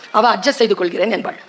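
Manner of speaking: ordinary delivery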